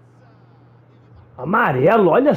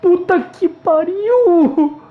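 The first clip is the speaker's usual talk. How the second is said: slow drawn-out exclamation; 7 semitones higher, much slower than usual. Real, not acted.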